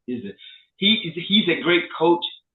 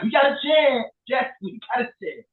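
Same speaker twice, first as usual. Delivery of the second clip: excited shout; 8 semitones higher than usual. Retold or imitated. Fan shouting that his team has a chance. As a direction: cheer like a fan seeing new hope. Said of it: "and I'm like".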